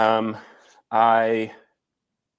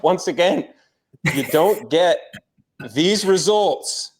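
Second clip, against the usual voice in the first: high, slow show-off flex; mock bragging; 7 semitones higher, 3 dB louder, slower than usual. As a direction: strike a pose, high and drawn out. Mock flex on his physique.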